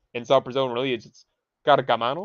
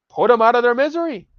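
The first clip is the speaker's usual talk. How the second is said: loud, high outburst; exasperated shout; 10 semitones higher, 8 dB louder than usual. Real, not acted. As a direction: yell it out in disgust. Shouting at chat comments.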